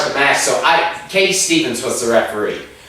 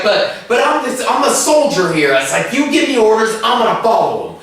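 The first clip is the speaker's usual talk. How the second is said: going off; 7 semitones higher than usual. Retold or imitated. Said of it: "I remember going off".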